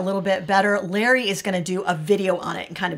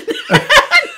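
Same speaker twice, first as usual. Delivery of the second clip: a sudden, much higher burst of laughter; 5 dB louder than usual. Real, not acted. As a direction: laugh out at your own joke. Laughs at her own prayer joke.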